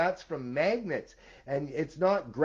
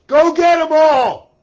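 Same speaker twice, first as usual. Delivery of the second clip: slow battle cry, far higher and much louder; full shout; 13 semitones higher, 19 dB louder than usual. Real, not acted. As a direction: yell it like a war cry. Repeats rallying call, now shouted.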